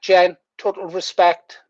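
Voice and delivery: usual speaking voice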